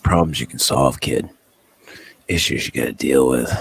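lightly voiced low advice to a kid; a hint of a low-voiced mentor; imitated advice-giver. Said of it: "guy told me that".

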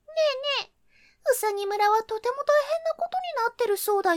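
high, fast chirpy gossip; little bird voice. Acted. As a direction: chatter like a small bird. Little birds gossiping in tree.

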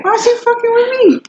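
high mock complaint; high whine. Real, not acted. Complaining about being teased.

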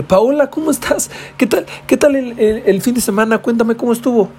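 put-on high, sweet eager little boy; eager greeting. Boy greeting his crush after the weekend, high eager. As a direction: gush it at your crush.

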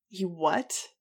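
loud startled disbelief; shocked outburst. A narrator's reading. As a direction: blurt it out in shock. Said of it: "Iggy burst out".

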